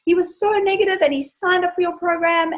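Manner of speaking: high excited disbelief; gushing that her husband signed up; amazed. Retold or imitated. Wife telling host about husband joining, high excited disbelief. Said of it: "And she was like".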